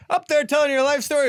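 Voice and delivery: high, loud jeering heckler voice; mocking heckler. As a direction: jeer at the comic like a drunk. Heckler quoted mocking comic.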